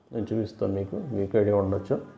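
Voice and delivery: usual speaking voice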